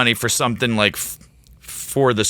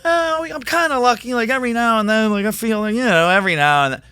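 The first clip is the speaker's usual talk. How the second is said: much higher, airy mock optimist; mock breezy lucky voice; 14 semitones higher than usual. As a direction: parody someone who calls themselves kinda lucky, pitched way up. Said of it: "But if you say".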